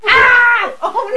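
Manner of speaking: high, wailed mock horror; mock alarm. Realising she is slipping into the accent, mock alarm. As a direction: cry out in playful dismay.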